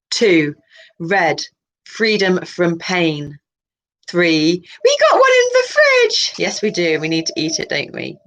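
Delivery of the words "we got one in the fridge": put-on much higher, little kid voice; childlike voice. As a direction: chirp it like an eager young child. Alter speaks in childlike voice.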